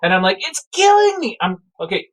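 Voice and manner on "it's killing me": very high panicked squeal; tearful mock screaming. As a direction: cry out like a terrified kid. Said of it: "I'm crying because I'm being crushed".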